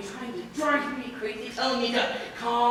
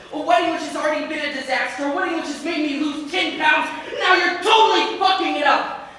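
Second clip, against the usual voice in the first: building, louder and higher rant; angry rant; 4 semitones higher, 6 dB louder than usual. Acted. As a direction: let the grievance mount to an outburst. Groom rants about his ruined wedding.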